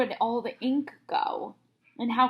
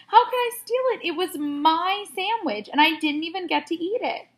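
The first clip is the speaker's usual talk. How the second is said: put-on higher, indignant kid voice; indignant protest; 8 semitones higher, 4 dB louder than usual. A narrator's reading. Boy defending himself to the officer, high indignant protest. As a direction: protest it's your own sandwich.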